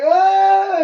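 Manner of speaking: high imitated cry of disgust; mock disgusted yell. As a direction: yelp it like the smell just hit you. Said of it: "I'm like".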